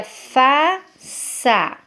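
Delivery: "faça" slowly broken in two, with a gap before the last syllable; slow, divided "faça"; split into syllables. Shown as a demonstration. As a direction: separate both syllables of "faça" for young readers. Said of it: "eu ia quebrar a sílaba sa".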